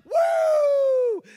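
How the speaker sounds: high, loud joyful whoop. Retold or imitated. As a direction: let out a big whoop of joy. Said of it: "I felt like".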